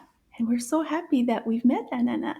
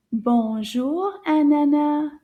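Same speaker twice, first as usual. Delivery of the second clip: slow, louder model greeting; calling out a greeting; 3 semitones higher, 5 dB louder, slower than usual. Shown as a demonstration. Teacher calling Anana with the children, calling out. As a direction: call it out for children to copy. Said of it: "Can you say that?".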